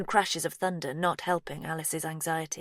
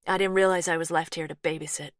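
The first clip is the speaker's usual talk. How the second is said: quick, firm, lightly American; a touch of American twang with a firm edge; soft American twang, firm; 5 dB louder, faster than usual. Acted. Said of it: "firmness in Laurie's softly spoken American twang".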